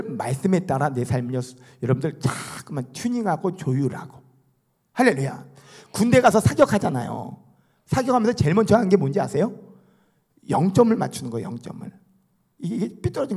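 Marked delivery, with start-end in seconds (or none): none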